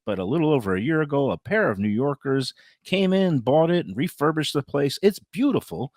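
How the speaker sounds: upbeat, punchy host endorsement; emphatic personal sponsor read; radio ad read. Acted. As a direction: pitch it like a hometown radio spot, leaning on the food names.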